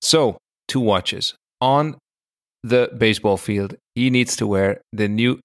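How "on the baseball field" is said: usual speaking voice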